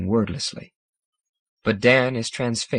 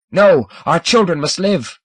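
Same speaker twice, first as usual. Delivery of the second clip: shout; 6 semitones higher, 6 dB louder than usual. A narrator's reading. Scomber's side shouting back.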